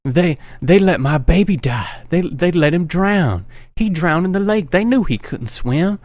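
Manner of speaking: a touch of a mother unhinged by grief; grieving mother. Retold or imitated. Missus Voorhees tells the girl about her drowned son, mildly anguished. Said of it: "she just goes nuts".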